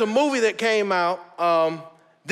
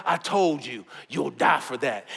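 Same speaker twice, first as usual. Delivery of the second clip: Thor voice; 9 semitones lower, 4 dB quieter than usual. Acted. Thor's line acted in a retold scene.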